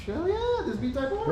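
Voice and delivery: high, loud excited cheer. Real, not acted. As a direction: cheer it out with delight. Excited about the Type R.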